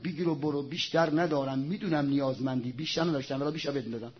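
low, soft, apologetic murmur; hushed, humble quote; gentle giving. Retold or imitated. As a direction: speak low and softly, as if humbly handing over a gift. Imam gently gives away all he has.

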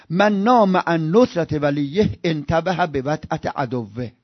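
slow, deliberate Arabic quotation; measured Arabic recitation. Acted. Preacher quoting Nahj al-Balagha, slow and deliberate. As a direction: weigh every word of the saying.

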